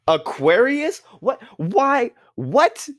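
disbelief. Real, not acted. Aquarius listed as air sign.

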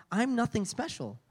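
ordinary delivery

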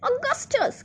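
high-pitched cry of the name; mildly agitated, high call. A narrator's reading. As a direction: cry out high without much extra volume. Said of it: "cried Mister Wonka".

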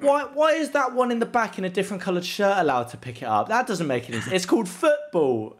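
high, loud mock indignation at the rules; mock clueless outrage. Pretending not to get football, mock outraged. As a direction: play the baffled newcomer to football.

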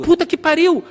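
high, animated burst; excited exclamation. Real, not acted. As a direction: blurt out delighted agreement. Excited agreement with co-host's remark.

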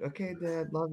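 placating son. Retold or imitated. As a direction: humour an angry dad quietly. Son placating his angry father.

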